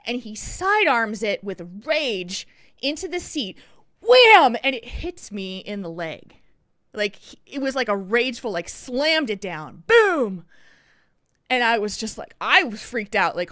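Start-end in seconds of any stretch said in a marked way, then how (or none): 4.0-4.6 loud, high shouted Wham mimicking a slam; mock slam noise, shouted; wham sound effect. Sound of bag slammed into seat. 9.9-10.4 boom sound effect. Retold or imitated. Imitating the slam of the bag.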